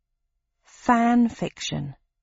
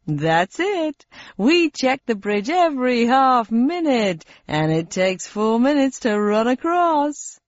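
slow, higher cheery quiz host; game show host voice; 4 semitones higher, slower than usual. Acted. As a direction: explain deadly rules brightly. Host finishes the rules.

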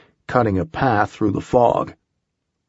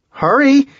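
loud high-pitched cry; urgent shout; 6 dB louder than usual. A narrator's reading. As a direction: yell it in panic. Holly trapped in vampire pit.

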